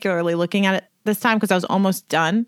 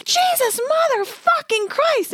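very high-pitched mock scream at contained volume; retold scream; slower than usual. Reliving the shock of kicking a bird, high mock scream. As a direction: re-enact a shocked shriek, stretched out slowly. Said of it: "at the top of my lungs".

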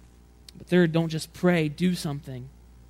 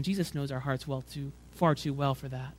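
ordinary talk, nothing marked

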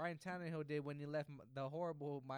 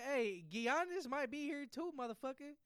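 mock threat; 10 semitones higher, 5 dB louder than usual. Imagined LeBron warning Adam Silver, mock threat. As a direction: stretch it out as a hammed-up threat.

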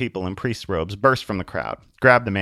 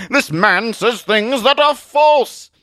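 high, loud, slow heroic accusation; indignant Zealot; 14 semitones higher, 6 dB louder, much slower than usual. Acted. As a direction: denounce a villain with grand outrage. Zealot denounces Atheist.

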